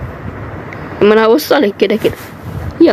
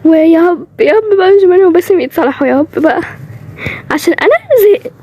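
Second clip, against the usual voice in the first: whiny nagging; 5 semitones higher than usual. Acted. Nagging to reconcile couple.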